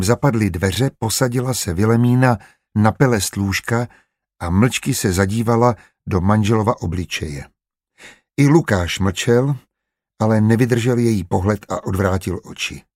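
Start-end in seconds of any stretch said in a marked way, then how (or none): none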